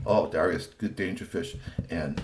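ordinary delivery

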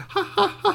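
high, light "ha ha ha"; playful laughter. A narrator's reading. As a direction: laugh like toys running wild. Toys romping in a bedtime tale.

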